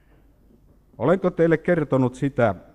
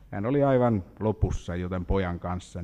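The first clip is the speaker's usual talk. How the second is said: ordinary talk, nothing marked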